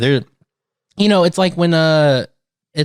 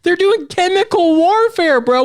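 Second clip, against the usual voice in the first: pitched-up outrage; worked-up rant; 9 semitones higher than usual. Real, not acted. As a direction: rant in disbelief. Ranting about acid attacks.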